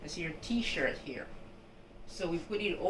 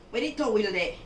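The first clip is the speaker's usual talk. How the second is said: Jamaican country patois, higher and louder; 6 semitones higher, 7 dB louder, faster than usual. Retold or imitated. Playing a Jamaican country speaker asking for the towel, broad patois. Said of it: "In Jamaica, they would say".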